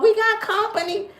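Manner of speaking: high, gleeful roach. Retold or imitated. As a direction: squeak it like excited roaches. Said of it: "They just come out".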